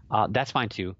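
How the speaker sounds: usual speaking voice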